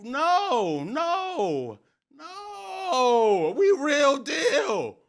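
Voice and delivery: high, loud, drawn-out protest; impassioned wail. Real, not acted. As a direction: wail it, stretching out the words. Wailing insistence about his team.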